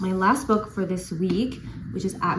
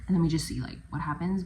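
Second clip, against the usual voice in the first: ordinary delivery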